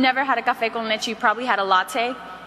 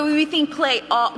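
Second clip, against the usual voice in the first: ordinary talk, nothing marked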